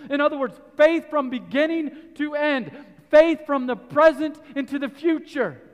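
loud, high preaching to the congregation; full-force preaching. Acted. Preacher pressing the point on faith, full force. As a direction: drive it home from the pulpit.

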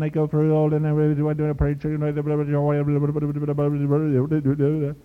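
rapid-fire monotone gabble; funny preacher voice, racing on one note; mock preacher auctioneer. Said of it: "Sounds like the auctioneer".

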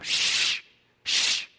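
drawn-out "shh"; long acted shush. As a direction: hush a noisy crowd. Pharisees hushing the disciples.

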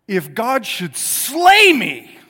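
loud, slow, rising hard on slay; emphatic declaration. Acted. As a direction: proclaim it slowly and forcefully. Job's defiant faith quoted in preaching.

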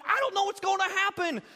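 hammed-up fretting; mock panic. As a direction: play your own freak-out for laughs. Acting out panicked self.

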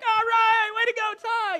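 high, loud, happy shout for a teammate; a boy whooping from the court; excited cheer. Retold or imitated. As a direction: cheer him on like a proud kid.

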